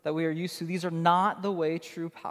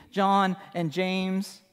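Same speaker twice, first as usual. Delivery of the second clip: ordinary delivery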